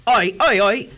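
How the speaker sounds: offended British caller. Pretend. British listener calling to complain, mock British accent. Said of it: "this guy is gonna be listening".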